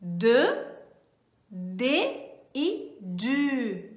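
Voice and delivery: De, de e du said slowly, each word apart; drawn-out de, de e du in French; slow articles. Shown as a demonstration. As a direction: spell out de, de and du slowly. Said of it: "Três formas".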